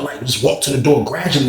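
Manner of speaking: ordinary talk, nothing marked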